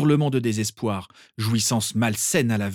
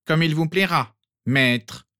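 higher, louder, with open scorn; contemptuous; 6 semitones higher, 4 dB louder, slower than usual. Acted. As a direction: make "maître" sound like an insult. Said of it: "de telle façon que le titre sonna comme une insulte".

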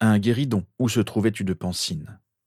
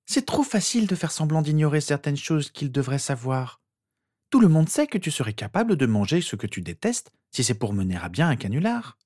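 put-on higher-pitched girl's voice; reasoning sister; 7 semitones higher than usual. A narrator's reading. Sister arguing any proof could be faked, skeptical. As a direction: explain it knowingly in a raised voice.

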